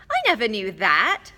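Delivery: surprised. A narrator's reading. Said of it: "looking at Ron in surprise".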